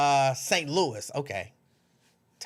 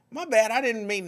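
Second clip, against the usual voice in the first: playful apology; 7 semitones higher than usual. Real, not acted. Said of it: "It was an N".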